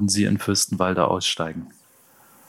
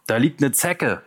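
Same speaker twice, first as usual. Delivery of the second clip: higher-pitched call; 6 semitones higher than usual. A narrator's reading. Thug alerts his gang to a sleeping punk, called out. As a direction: call out a find to the others. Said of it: "rief einer".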